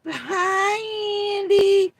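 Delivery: very high, loud squealing falsetto; mock swooning. Mocking fans fawning over Andy in a shrill squeal. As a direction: squeal at a crush. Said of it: "Jeannie and Andy are like".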